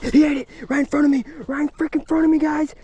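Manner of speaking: high, loud, thrilled shouting; excited yelling. Real, not acted. Angler sees the redfish take his fly, thrilled high shouting. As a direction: yell it out as the fish eats the fly.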